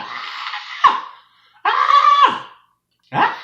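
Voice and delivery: piercing high shriek of a coaster rider; reenacted ride scream. On the ride, reliving her fear for viewers: a very high, loud shriek. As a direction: scream it out like the ride just dropped. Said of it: "I'm screaming".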